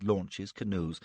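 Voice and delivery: usual speaking voice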